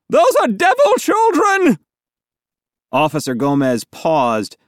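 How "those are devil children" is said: very high, loud screech; old woman's cry. A narrator's reading. Old woman accusing children to police, crying out. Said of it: "cried the old woman with the chihuahua".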